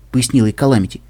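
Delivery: usual speaking voice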